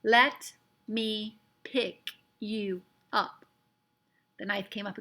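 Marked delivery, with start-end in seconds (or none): 0.0-3.5 very slow, each word spaced; very slowly. A narrator's reading. Omri asking the Indian to be held, very slowly. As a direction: spell it out word by word. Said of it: "he said very slowly".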